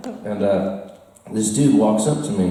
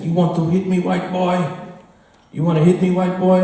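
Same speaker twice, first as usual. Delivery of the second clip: higher-pitched taunt in an imitated Chinese accent; mock Chinese accent; 7 semitones higher than usual. Club heavy baiting the tourist in the back alley, higher mock Chinese accent. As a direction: goad him in a put-on Chinese accent. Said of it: "maybe I shouldn't use this accent".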